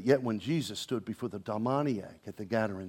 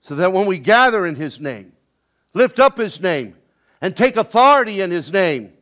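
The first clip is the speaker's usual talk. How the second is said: loud, ringing sermon voice; preaching at full force; 5 semitones higher, 14 dB louder than usual. Acted. Preacher rousing the congregation, preaching at full force. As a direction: preach it out to the whole church.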